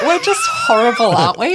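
high, loud exclamation through laughter; laughing dismay. Real, not acted. Host owning up to Australians' failing, laughing dismay. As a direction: cry it out, half laughing.

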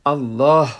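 "الله" said loud with a full, airy final h; final h sounded. Shown as a demonstration. Teacher models correct recitation, final h sounded on "الله". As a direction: finish "الله" on a clearly released h. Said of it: "باید او را درست تلفظ کنیم".

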